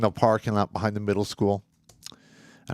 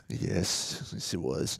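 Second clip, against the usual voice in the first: much softer, hushed reply to the evil voice; answering his gloating evil side quietly; quiet agreement; 5 semitones lower, 12 dB quieter, much slower than usual. Retold or imitated. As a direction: admit it under your breath. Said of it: "And I'm like".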